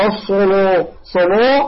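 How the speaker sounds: الصلو with dark rounded "law"; wrong heavy lam. Shown as a demonstration. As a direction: mimic the over-heavy صلو error. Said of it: "خطأ".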